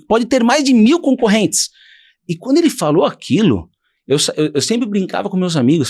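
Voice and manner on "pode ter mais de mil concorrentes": rising higher, slower preacher's push; preaching bishop. Retold or imitated. Bishop preaching to the church about following God's word, rising higher and slower. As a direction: urge them on like a bishop from the pulpit. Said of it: "você usou essa palavra saturado".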